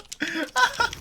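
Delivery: squealing high laughter. Real, not acted.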